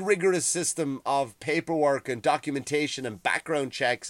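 ordinary delivery

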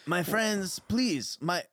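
smooth things over with a pleading lilt; Fertone, a placating host. Acted. Fertone vouching for his friend, placating.

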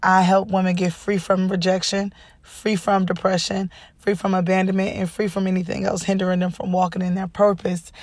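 smooth show-opener voice; podcast intro read. Acted. Host introducing Women Be Free Podcast, smooth announcer read.

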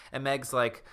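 ordinary talk

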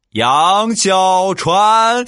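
high, very slow drawn-out call; stern full-name call. Retold or imitated. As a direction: stretch the name like a parent about to punish. Parent calling full name sternly.

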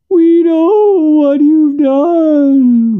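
high, loud, long-held spirit cry; ghostly wail. A narrator's reading. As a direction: wail it as a chorus of spirits, stretching the vowels. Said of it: "as if there really might be several spirits".

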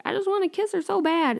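lightly mocking high voice; Peter. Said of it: "Peter's just like".

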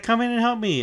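put-on very high, loud girlish plea; mock Nami cry. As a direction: squeak it out like a damsel calling for help. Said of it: "She's like".

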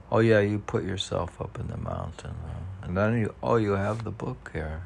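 normal talking voice

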